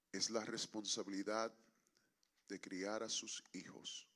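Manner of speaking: low, soft praying with long pauses; solemn prayer. Real, not acted. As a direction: lead the congregation quietly into earnest prayer. Leads congregation in prayer.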